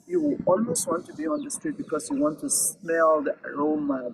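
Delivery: usual speaking voice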